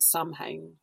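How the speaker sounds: "Samhain" read letter by letter, with M sounded; mispronounced Samhain. Shown as a demonstration. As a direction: say Samhain as it looks, "SAM-hayn". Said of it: "it looks like it's pronounced".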